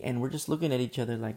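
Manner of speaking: ordinary delivery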